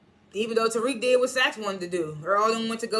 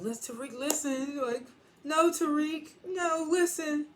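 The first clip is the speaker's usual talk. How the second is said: mock pleading; 7 semitones higher, slower than usual. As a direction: beg him not to go. Effie pleading, voiced by host.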